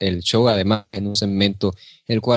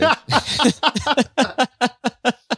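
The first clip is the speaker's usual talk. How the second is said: high burst of laughter; 13 semitones higher than usual. Real, not acted. Cohost laughs at his own Fede joke, high real laughter.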